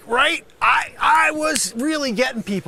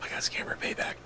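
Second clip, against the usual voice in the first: defeated whisper; 12 dB quieter, faster than usual. Acted. Dismayed realization after sigh.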